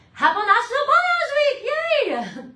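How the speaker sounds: high, loud celebratory shout; excited cheer. Real, not acted. Host celebrating Balayage Week with viewers, excited cheer. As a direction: cheer it out with a big high yay.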